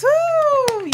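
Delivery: thrilled host whooping after a poem; excited whoop. Real, not acted. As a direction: cheer the poem like a fan.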